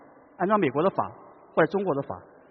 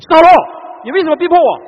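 loud, furious higher accusation; the wrong way, an angry, loud, high rebuke; 11 semitones higher, 14 dB louder than usual. Shown as a demonstration. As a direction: shout it as an enraged accuser. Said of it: "充满着愤怒".

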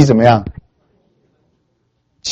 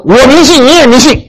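heated retort; 10 semitones higher, 4 dB louder than usual. Retold or imitated. Atheist snaps back.

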